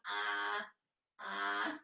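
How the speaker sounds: low, open-jawed "ah"; silly jaw-release groan; funny "ah" noise. Shown as a demonstration. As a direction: let the jaw drop on a low, held "ah". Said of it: "You can make that funny noise".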